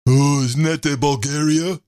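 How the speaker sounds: ordinary delivery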